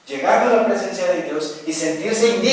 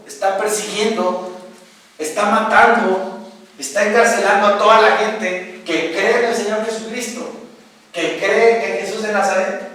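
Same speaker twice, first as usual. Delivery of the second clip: louder, higher preaching; louder, impassioned preacher; rising sermon fervor; 3 semitones higher, 3 dB louder than usual. Acted. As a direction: build the fire of the sermon. Preaching at full force.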